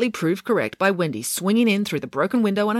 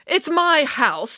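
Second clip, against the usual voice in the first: high, slow, drawn-out assertion; indignant, raised-pitch declaration; emphatic declaration; 10 semitones higher, much slower than usual. A narrator's reading. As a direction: stake your claim firmly. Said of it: "declared Nanny Piggins".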